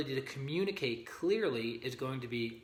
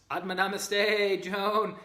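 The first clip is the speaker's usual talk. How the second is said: calling out a big hello, higher and louder; loud greeting; 7 semitones higher, 8 dB louder, slower than usual. Real, not acted. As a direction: greet her across the room, slow and bright. Greeting a viewer in live chat.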